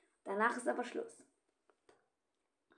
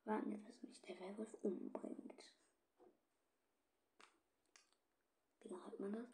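soft voiceless whisper; resigned whisper; 10 dB quieter than usual. Real, not acted. Player waiting to be killed, soft whisper.

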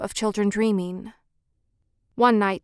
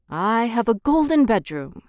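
ordinary delivery, nothing marked

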